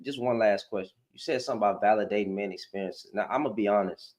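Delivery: ordinary talk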